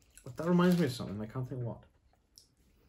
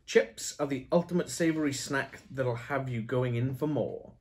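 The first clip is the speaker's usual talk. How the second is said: ad read; 4 semitones higher, 5 dB louder, slower than usual. Pretend. Reading packet copy aloud.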